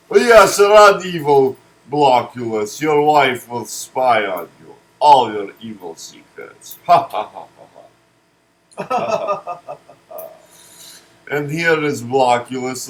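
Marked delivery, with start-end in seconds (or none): 0.1-6.8 mock taunt. Taunting the fleeing villain. 6.8-9.8 evil laugh. Pretend. Laughing at the villain.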